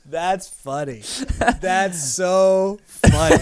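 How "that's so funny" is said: slow, drawn-out amused reply; amused, laughing. Real, not acted. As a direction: savor the joke slowly.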